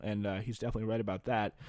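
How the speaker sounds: usual speaking voice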